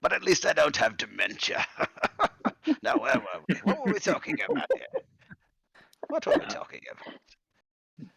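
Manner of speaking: high, loud, laughing confusion; doddery old man chuckling, then baffled; cheerfully senile old man. Acted. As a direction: burst out brightly, laugh, then lose the thread. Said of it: "he smiles real quick and he goes".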